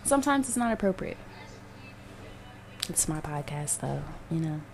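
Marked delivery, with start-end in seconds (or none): 2.9-4.6 muttered aside. Real, not acted. Muttering to herself mid-rant.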